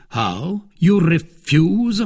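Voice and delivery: slow, high-pitched disbelief on the second question; astonished. A narrator's reading. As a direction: cry out in astonishment at a refusal. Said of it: "cried the cardinal with astonishment".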